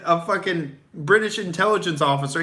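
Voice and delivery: usual speaking voice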